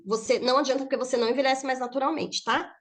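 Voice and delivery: normal talking voice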